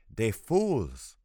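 put-on loud, contemptuous old woman's voice; scornful grandmother. A narrator's reading. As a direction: spit out the words with disdain.

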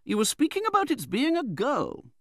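high, authoritative matron; Betsy Trotwood. A narrator's reading. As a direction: take up the subject firmly, high-pitched. Aunt resumes her argument.

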